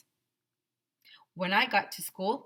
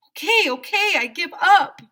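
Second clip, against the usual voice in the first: surrender; 12 semitones higher, 13 dB louder than usual. Acted. Hero surrendering to the goons.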